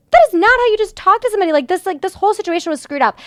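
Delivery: high, fast indignant retort. Retold or imitated. To the rude customer service guy, high fast indignant retort. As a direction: snap back fast and high.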